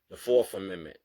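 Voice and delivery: normal talking voice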